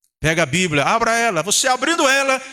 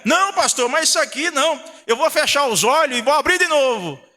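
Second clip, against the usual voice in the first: higher-pitched mock protest; crente recusando; 7 semitones higher than usual. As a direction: lightly act out a member objecting to the pastor. Voicing a member refusing the verse. (protesto encenado de crente)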